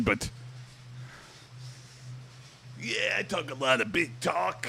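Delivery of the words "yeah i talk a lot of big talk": gravelly wrestler promo growl, pitched up; Macho Man Randy Savage. Retold or imitated. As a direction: hype yourself up like a wrestling legend. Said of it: "Macho Man Randy Savage impression".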